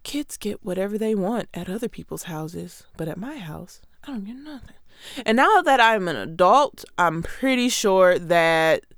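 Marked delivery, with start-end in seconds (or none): 0.0-4.8 softly, a hushed inner grumble; much softer sulky thought. Retold or imitated. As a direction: mutter it to yourself like a sulking kid. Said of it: "in my mind".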